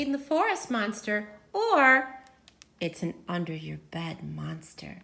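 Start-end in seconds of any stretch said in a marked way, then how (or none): none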